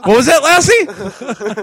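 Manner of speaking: high, loud, slow question to a pet; dog owner. Acted. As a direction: exaggerate it as if decoding a dog's barks. Acts out owner questioning heroic dog.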